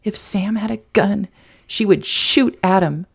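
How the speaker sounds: usual speaking voice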